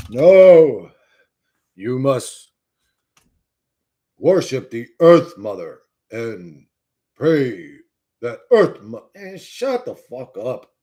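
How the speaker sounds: slow, loud hammed-up sermon voice; lightly voiced solemn believer; mock preacher. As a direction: lay it on like a zealot preaching. Mocking Earth Mother believers.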